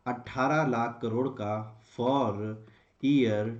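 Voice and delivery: slow, spaced-out dictation; dictating. Shown as a demonstration. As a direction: say it slowly for students to write down. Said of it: "हम target भी लिख सकते हैं".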